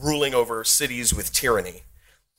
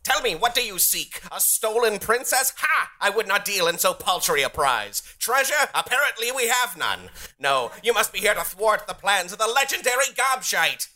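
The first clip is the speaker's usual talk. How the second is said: pompous goblin villain; 9 semitones higher than usual. Acted. Goblin boss villain speech.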